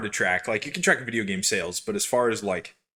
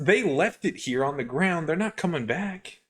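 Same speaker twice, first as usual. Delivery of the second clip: mock innocent; 9 semitones higher than usual. Mock justifying looting.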